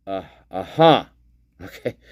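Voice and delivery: usual speaking voice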